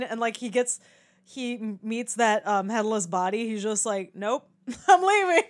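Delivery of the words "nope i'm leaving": higher-pitched comic refusal with a laugh; lightly imitated bailing out; mock hasty exit. Guard bailing on the headless body, mock hasty and higher.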